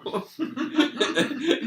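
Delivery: loud squealing laughter; high laugh. Real, not acted. Rit de sa mère. (éclat de rire aigu)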